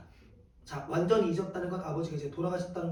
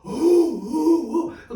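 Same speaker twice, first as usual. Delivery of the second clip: mock sob; 8 semitones higher, 9 dB louder, much slower than usual. Acting out a breakup sob.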